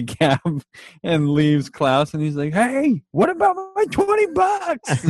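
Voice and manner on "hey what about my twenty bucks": a very high squeaky call; calling after him. Retold or imitated. Klaus calling after Five as he leaves, very high and squeaky. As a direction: yell it after someone leaving.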